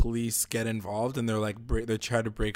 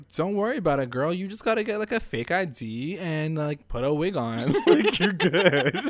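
high, sassy imitation of a confident friend; mock-breezy girlfriend advice, breaking into laughter; breezy reassurance; 6 semitones higher, 4 dB louder than usual. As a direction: wave the problem away in a high lilt. Acting out Cheryl's breezy advice.

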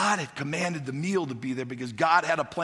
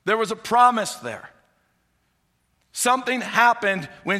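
raised, louder preaching voice pressing the point; fervent preaching; 7 semitones higher, 6 dB louder than usual. Acted. Pastor preaching the widow's promise to church, fervent. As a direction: preach it with rising conviction to the congregation.

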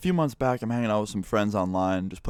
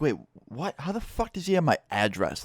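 higher, startled retelling of his own panic; shocked disbelief; 6 semitones higher than usual. Retold or imitated. Caught off guard by his address in chat, shocked. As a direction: gasp it out like the floor just dropped.

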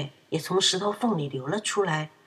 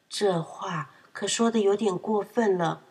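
ordinary delivery, nothing marked

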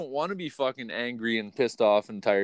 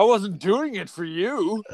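higher, drawn-out comeback; teasing, stretched-out mock reply; mock retort; 7 semitones higher, slower than usual. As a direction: toss it back slowly in a raised voice.

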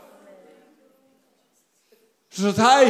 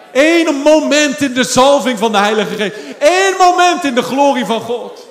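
loud, raised proclamation; fervent preaching; 4 semitones higher, 7 dB louder than usual. Real, not acted. Preacher proclaiming God's glory to the church, loud and fervent. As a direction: ring it out to the whole church.

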